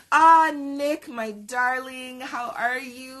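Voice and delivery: high, drawn-out "darling" greeting; gushing greeting. Real, not acted. Host breaks off her story to greet Nick, gushing. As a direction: greet them lavishly, stretching the words out.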